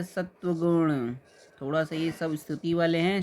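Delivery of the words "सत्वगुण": सत्वगुण slowly, sat-va-gun; correct word form. Shown as a demonstration. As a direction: stretch the syllables of सत्वगुण. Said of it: "होता है".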